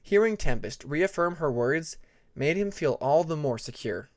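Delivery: ordinary delivery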